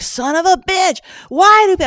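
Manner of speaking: high, loud exasperated outburst; retold yelling alone in the car. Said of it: "I'm yelling in the car".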